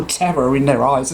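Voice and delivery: ordinary talk, nothing marked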